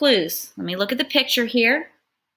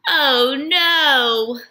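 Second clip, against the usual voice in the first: nasal sneezy voice; 5 semitones higher, 5 dB louder than usual. Acted. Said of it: "You see how I'm changing my voice?".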